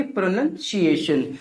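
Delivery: प्रोनंसिएशन slowly as pro-NUN, see-ay-shun; slow प्रोनंसिएशन down, clear "nun" in the middle; syllable breakdown. Shown as a demonstration. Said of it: "क्या बोलेंगे?". (प्रोनंसिएशन को धीरे "प्रो-नन-सिएशन" बोलें)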